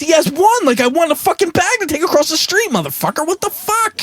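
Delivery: high-pitched exasperated rant. Retold or imitated. Retold angry argument over bag.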